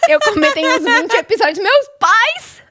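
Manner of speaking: high, loud fangirl shriek; excited shriek. Real, not acted. Excited fan outburst.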